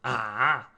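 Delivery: 第三声的啊; third tone ah. Shown as a demonstration. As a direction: dip the voice down then up on ah. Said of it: "The third one".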